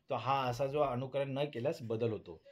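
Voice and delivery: ordinary talk, nothing marked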